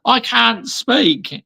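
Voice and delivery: high, slow, stunned pundit voice; imitating Mark Goldbridge; Mark Goldbridge impression. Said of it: "That is from Mark Goldbridge".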